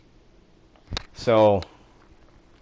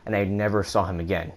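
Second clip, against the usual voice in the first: ordinary talk, nothing marked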